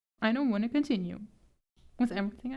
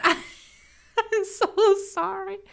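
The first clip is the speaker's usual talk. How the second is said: laughing apology; 9 semitones higher, 4 dB louder, much slower than usual. Real, not acted.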